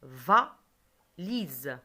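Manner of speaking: "Va, lise" split, with a loud va, a gap, then lise; "Va, lise" as two detached teaching beats; syllable by syllable. Shown as a demonstration. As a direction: punch "Va", pause, then say "lise". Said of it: "On a deux syllabes".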